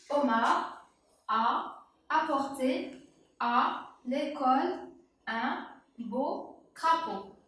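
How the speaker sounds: slow, word by word dictation reading; lecture lente. Shown as a demonstration. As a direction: read it out slowly for the child. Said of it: "Je répète". (lecture lente, mot à mot)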